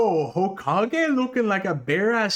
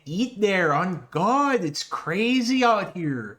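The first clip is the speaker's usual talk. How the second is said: mock Toronto roadman slang, dragged out; imitating the Toronto accent, slow and slangy; 4 semitones higher, slower than usual. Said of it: "the ugly Toronto accent where they're like".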